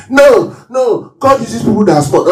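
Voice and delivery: high, hammed-up protest; mock indignant shout. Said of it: "it's not by shouting".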